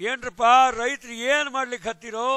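rapid, lively Dharwad Kannada; Dharwad Kannada dialect. Shown as a demonstration. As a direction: call out to farmers in Dharwad dialect. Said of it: "I use their dialect".

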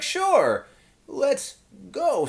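high, bright-eyed eager sure; eager agreement. Retold or imitated. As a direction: fan thrilled to tag along. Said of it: "And I was like".

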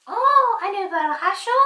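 excited gasp, rising question; surprised puppet. Pretend. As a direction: puppet thrilled about a surprise. Puppet hears of a present.

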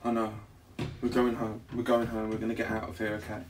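soft, gentle repeated promise; reassuring. Real, not acted. Promising fiancee they'll get out, reassuring. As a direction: soothe a scared partner quietly. Soothing sick, scared fiancee.

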